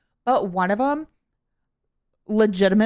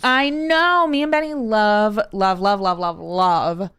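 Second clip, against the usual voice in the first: loud, emphatic fan gushing; gushing enthusiasm; 5 dB louder, slower than usual. Real, not acted. Fan telling co-host she loves Drag Race, gushing. Gushing over favourite TV show.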